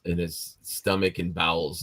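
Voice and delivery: usual speaking voice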